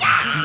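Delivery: high squeal of celebration; acted-out elevator shriek; excited mock scream. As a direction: squeal like friends who just got in.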